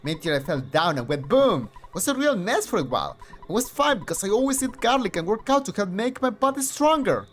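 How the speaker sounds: high, loud, eager neighbour voice; boastful chatty kid next door; loud excited neighbour. Acted. Voices game neighbour character.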